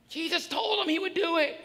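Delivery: ordinary talk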